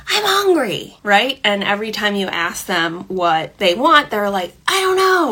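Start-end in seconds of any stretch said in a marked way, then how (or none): 0.1-1.0 put-on high-pitched whiny kid's outburst; hungry kid's mock scream, not full volume; mock child's yell. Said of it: "yelling, screaming, like". 4.7-5.3 high-pitched imitation of an upset kid; mock kid outburst; mock child's yell. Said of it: "your child's yelling".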